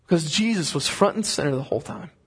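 ordinary delivery

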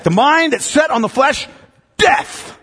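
"flesh" rising high, then a pause and "death." breathed out hushed; emphatic pause before death. Shown as a demonstration. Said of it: "That's how he says it".

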